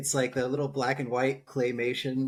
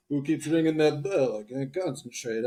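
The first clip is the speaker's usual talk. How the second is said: a hint of groggy Rocky; lightly voiced punch-drunk boxer; mock Rocky, fading to a mumble; hungover Rocky quote. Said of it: "I'd be like".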